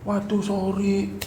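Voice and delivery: mock apologetic whine; fake excuse. Making an excuse to Mas Kris.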